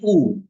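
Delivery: U said as a clear oo vowel, not as the letter Y. Shown as a demonstration. Teacher to students, showing upsilon is read U, clear oo. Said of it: "Tapi bacanya bukan Y".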